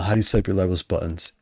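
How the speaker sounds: usual speaking voice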